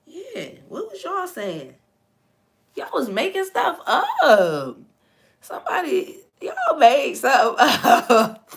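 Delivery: amused disbelief at the chat; laughing tease. Real, not acted. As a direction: call them out through laughter. Teasing chat for making stuff.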